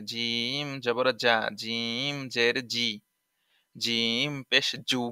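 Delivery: slow, জা জি জু said firmly from mid-tongue; firm jim demo. Shown as a demonstration. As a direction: drill জিম hard and slow. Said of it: "জিম জিহ্বার মাঝখান হতে শক্তভাবে আদায় হবে". (ধীরে শক্তভাবে জিমের উচ্চারণ)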